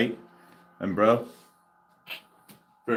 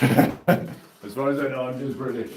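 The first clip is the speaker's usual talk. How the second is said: mock British accent; 3 semitones higher than usual. Streamer echoing a viewer's line, mock British accent.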